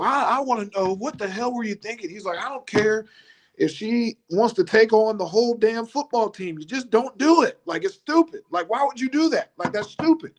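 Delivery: mock coach rant, voice high; high-pitched upset coach. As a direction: squeak it out like a big upset coach. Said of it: "his voice gets high".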